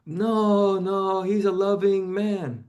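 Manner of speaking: high, mock-dismissive mother voice; mother's denial. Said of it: "she'd be like".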